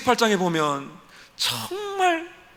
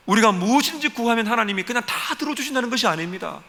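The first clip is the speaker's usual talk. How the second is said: ordinary delivery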